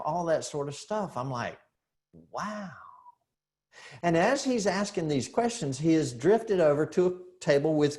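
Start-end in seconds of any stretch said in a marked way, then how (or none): 2.3-2.7 soft amazed wow. Retold or imitated. Dad stunned by questions.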